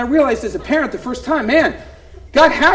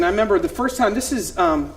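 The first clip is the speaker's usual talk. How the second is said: ordinary delivery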